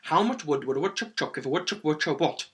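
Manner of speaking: rattled off quickly; quick tongue twister. Shown as a demonstration. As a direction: race through the tongue twister, slipping slightly. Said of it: "start to get really quick at it".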